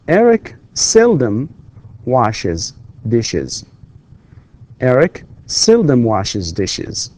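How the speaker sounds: "Eric seldom washes dishes" read slowly for the class; slow model reading. Shown as a demonstration. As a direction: read each word out slowly. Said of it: "model sentence repeated".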